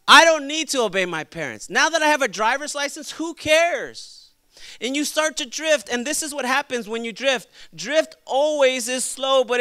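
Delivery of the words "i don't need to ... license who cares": put-on raised, pushy teenage defiance; defiant teen. Preacher voices a newly licensed teenager, defiant. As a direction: play a cocky teen brushing off parents.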